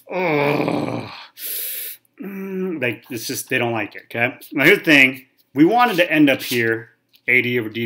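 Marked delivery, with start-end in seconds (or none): 0.1-2.8 mock exasperated sigh, groan and whimper; imitated student groans.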